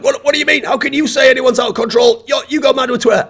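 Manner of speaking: high, whiny mock outrage; mocking lament. Mocking Twitter critics who defend KNG, high whiny outrage. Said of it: "people are gonna lament".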